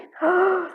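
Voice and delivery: sharp gasp of wonder; breathtaken gasp. Real, not acted. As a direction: gasp as if your breath was taken away. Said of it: "You're taking my breath away".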